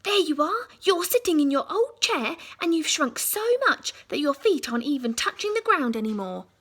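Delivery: loud, triumphant shout; shouting Mister Twit. Acted. Husband pointing at his wife, loud triumphant shout. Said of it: "pointed at her and shouted".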